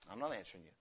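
low, soft refusal; quiet refusal. Acted. Someone refusing a questioner, low and quiet. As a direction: refuse quietly, dropping right down.

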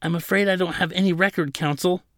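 high-pitched, apologetic fluster; frazzled. A narrator's reading. Attendant with no record to show counsel, frazzled. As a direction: try to help while getting rattled. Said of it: "tried to be helpful, but he was getting frazzled".

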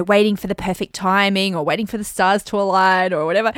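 ordinary talk

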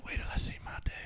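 a mock ASMR whisper; ASMR whisper for the listeners. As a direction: whisper it like a joke ASMR read. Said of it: "We're on the whisper portion".

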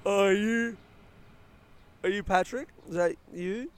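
unsure question. Retold or imitated. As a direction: ask it like you're not sure.

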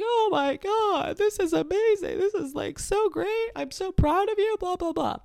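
high-pitched imitation of a gushing mom; mock tearful joy, way up high; overjoyed crying mom. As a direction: gush proudly, as a mom retold. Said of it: "My mom starts crying 'cause she's like".

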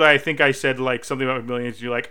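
ordinary talk

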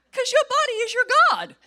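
high, loud cry of rebuke; forceful preaching shout. Real, not acted. Preacher rebuking people ruled by hunger, high forceful shout.

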